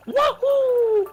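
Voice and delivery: very high hammed-up woohoo; Gordo voice. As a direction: squeal it way up high. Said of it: "Nah, uh, more like".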